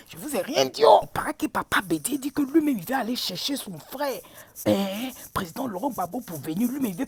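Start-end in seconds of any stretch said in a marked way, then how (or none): 0.1-4.2 secretive unvoiced whisper. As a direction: lean in and whisper the gossip. Sharing a secret rumour.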